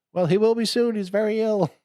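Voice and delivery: mock medieval cheer; silly high voice. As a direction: chirp it out brightly in a high put-on voice. Quoting the sketch.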